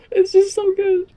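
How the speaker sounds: high, gushing squeal of delight. Real, not acted. Fan squealing to viewers about the episode.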